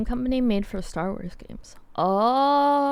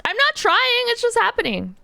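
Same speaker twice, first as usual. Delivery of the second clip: exasperated; 13 semitones higher, 3 dB louder than usual. Real, not acted. Defending against computer crash teasing.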